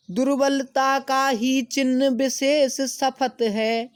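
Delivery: slow, high, loud declaiming; slow lofty verse reading; poem recitation. Acted. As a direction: chant the verse slowly to the class. Recites poem line, then explains.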